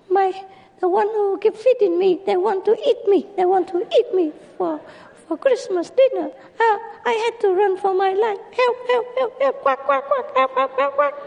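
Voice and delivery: very high, fast squawking duck; a duck running for its life, shrieking; high quacking cries for help; frantic duck. Acted.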